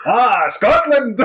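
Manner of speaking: drawn-out, high fake Albanian accent; hammed-up slow Albanian wonder; put-on Albanian accent. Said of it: "I can't put this on".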